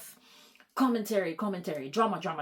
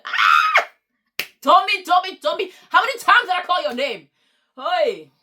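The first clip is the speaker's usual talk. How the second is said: loud, higher exasperated call to someone off-mic; calling Tommy; 12 semitones higher, 14 dB louder than usual. Real, not acted. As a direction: yell the name to get him to answer.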